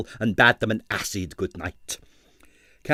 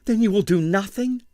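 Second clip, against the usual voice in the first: high, urgent, desperate pleading; distressed dancer urging the inspector; pleading ballerina; 10 semitones higher, 3 dB louder than usual. A narrator's reading. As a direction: beg him to understand, voice up high. Ballerina despairs.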